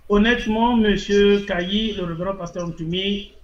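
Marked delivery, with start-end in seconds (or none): none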